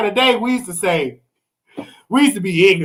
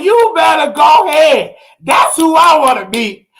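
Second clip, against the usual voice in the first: loud, high, excited call-out; excited shout; 6 semitones higher, 4 dB louder than usual. Real, not acted. Reacting to the ad with an excited shout. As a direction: hype it up like he can't believe it.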